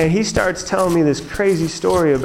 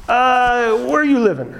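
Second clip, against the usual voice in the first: Ron; 8 semitones higher than usual. Retold or imitated. Older mentor questioning his student by phone, imitated high voice. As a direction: stretch the opening sound, pitched well up. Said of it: "This is my Ron impression".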